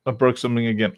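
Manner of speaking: ordinary talk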